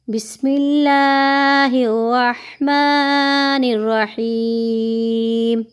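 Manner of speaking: slow, melodic Quranic chant; drawn-out recitation, every vowel held. Shown as a demonstration. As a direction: recite it slowly with tajweed.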